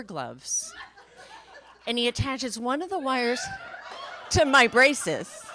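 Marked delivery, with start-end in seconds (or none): 1.9-5.4 laughing while telling. Real, not acted. Laughing through the absurd part.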